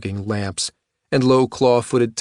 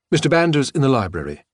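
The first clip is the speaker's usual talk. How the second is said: butler voice; 4 semitones higher, faster than usual. Acted. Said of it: "You sound like the butler".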